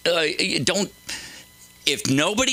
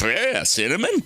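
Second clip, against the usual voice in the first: ordinary delivery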